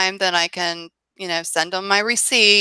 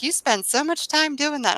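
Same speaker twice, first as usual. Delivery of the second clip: higher-pitched imitation of a disbelieving partner; mock incredulity; 7 semitones higher, 4 dB quieter than usual. John looks at her time spent, mock incredulous. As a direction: quote his disbelief, pitched up. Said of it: "he's like".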